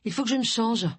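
short remark thrown over the shoulder; tossed off. A narrator's reading. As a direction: blurt it out while heading off. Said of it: "lâcha Agatha".

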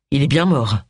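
mildly grave confirmation; understated sombre male character. A narrator's reading. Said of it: "confirma John sombrement".

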